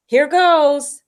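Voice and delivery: a loud, high burst of nerve before the plunge; loud nervous resolve; bracing himself. A narrator's reading. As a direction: psych yourself up and blurt it out. Deep breath before wading in.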